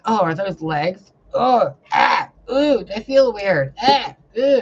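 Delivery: loud, rising oohs and ahs; marvelling at new legs. Retold or imitated. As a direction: gasp at weird new legs. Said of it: "I'm like".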